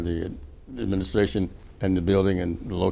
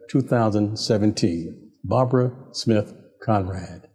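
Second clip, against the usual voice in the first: higher-pitched, formal announcer read; radio feature read; 3 semitones higher, 4 dB louder than usual. Acted. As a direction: read out a tribute with measured pauses before names. Host reads prepared history feature.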